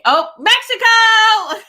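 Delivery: high, slow sung-out Mexico; excited. Real, not acted. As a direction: draw out Mexico with delight.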